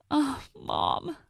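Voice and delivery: a soft sob over the boxes; crying softly. Acted. Daughter crying over her dead mom's boxes, tearful. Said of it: "I cry over the boxes".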